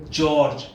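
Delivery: जॉर्ज said as one syllable, "jorj"; correct pronunciation. Shown as a demonstration. Teacher quizzing students on जॉर्ज, saying "jorj". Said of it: "क्या बोलेंगे इसे?".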